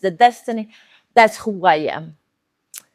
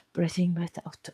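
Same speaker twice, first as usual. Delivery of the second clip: whispered, much softer; whispered breathing cue; 4 semitones lower, 11 dB quieter than usual. Shown as a demonstration. Guide listeners through the breath, whispered. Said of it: "demonstrating breathing".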